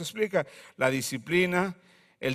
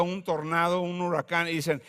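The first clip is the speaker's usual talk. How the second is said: ordinary talk, nothing marked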